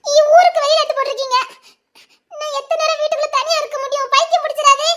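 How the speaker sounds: high, fast pleading protest; indignant protest. Acted. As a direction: push back, half-offended. Wife protests being stuck home. (வீட்டில் தனியா இருக்க முடியாதுன்னு மனைவி படபடப்புடன் எதிர்க்கிறாள்)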